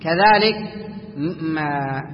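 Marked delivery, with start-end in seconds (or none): none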